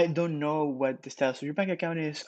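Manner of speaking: normal talking voice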